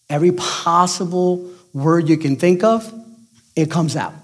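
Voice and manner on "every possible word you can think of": usual speaking voice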